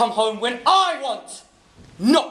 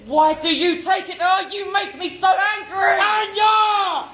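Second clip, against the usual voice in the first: furious shout; 8 semitones higher than usual. Acted. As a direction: roar it, losing control. Husband's climactic shouting.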